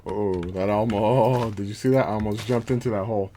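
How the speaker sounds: loud, alarmed outburst; startled by near miss. Real, not acted. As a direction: blurt it out after almost falling in. Nearly fell into a hole.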